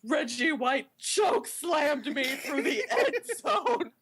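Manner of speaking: slow, high, anguished declamation; hammed-up melodrama cracking into laughter; mock wrestling promo. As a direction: stretch every word like a humiliated wrestler. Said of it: "if they ever uttered the sentence".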